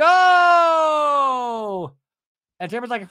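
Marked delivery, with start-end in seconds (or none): 0.0-1.9 loud, high drawn-out "oh"; exaggerated collective gasp of outrage, shouted; mock group outcry. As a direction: cry out like a shocked crowd. Acting out group's shocked reaction.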